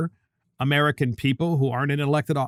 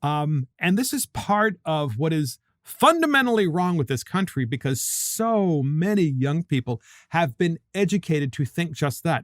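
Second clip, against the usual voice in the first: ordinary talk, nothing marked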